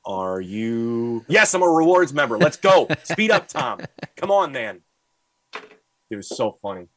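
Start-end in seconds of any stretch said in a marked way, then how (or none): 0.0-1.2 imitating a very slow old clerk, words drawn out; painfully slow talker. Old Walgreens clerk greeting a customer, painfully slow. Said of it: "took his time doing everything, even talking". 1.3-4.8 higher, louder mock exasperation; impatient. Acted impatient customer hurrying slow Tom.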